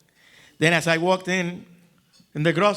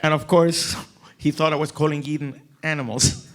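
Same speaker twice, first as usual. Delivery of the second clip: normal talking voice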